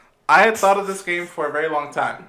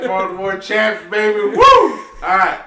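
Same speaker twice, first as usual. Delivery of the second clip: whooping cheer; 4 semitones higher, 5 dB louder than usual. Real, not acted. Champion celebration.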